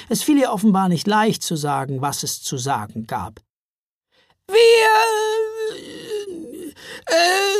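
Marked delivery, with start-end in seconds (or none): 4.5-7.5 high, strained, slow troll croak; creaking troll voice. A narrator's reading. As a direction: croak it out like a nervous troll woman. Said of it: "knarrte sie schließlich".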